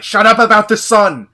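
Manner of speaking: furious boss shouting; loud angry shout; shouted quote. Retold or imitated. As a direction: yell it high and loud. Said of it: "He's like".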